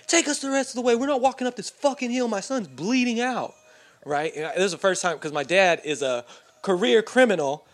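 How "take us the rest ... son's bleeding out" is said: higher, urgent angry demand; dad flipping out. Retold or imitated. Dad yelling at police to drive bleeding son, angry and high. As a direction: lay into the cops. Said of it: "my dad flips out on the cops".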